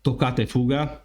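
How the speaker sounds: "toccata e fuga" said with native Italian vowels and rolled double consonants; Italian pronunciation. Shown as a demonstration. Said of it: "I'm gonna show off my Italian".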